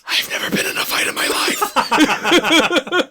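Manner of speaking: high, drawn-out whispery squeal; mock panic. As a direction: confess it in hammed-up, high breathy panic. Timid Joey secretly confesses his fear.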